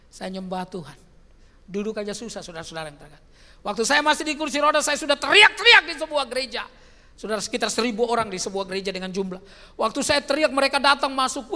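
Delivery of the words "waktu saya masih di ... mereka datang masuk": loud, high fervent preaching; louder fervent preacher's call; preaching at full force. Real, not acted. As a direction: drive it home to the church.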